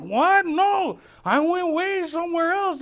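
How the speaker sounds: very high, loud indignant denial; drunk protest. Retold or imitated. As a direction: insist in shocked disbelief. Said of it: "I'm like".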